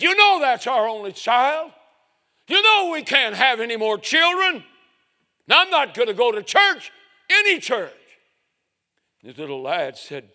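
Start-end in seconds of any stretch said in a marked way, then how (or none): 0.0-7.9 loud and high, voice strained; heated refusal. Retold or imitated. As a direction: declare it, shouting down the room. Roy refuses to go to church.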